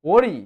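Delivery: "ওরি" loud, as worry with puckered w; rounded W sound. Shown as a demonstration. Teacher giving another W example, "ওরি" with rounded lips. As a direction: pucker both lips to begin "ওরি" firmly. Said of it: "kissing মুডে আনতে হবে".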